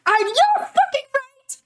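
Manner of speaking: very high, loud and drawn out; real thrilled yell at chat; excited shout. Real, not acted. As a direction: scream your agreement.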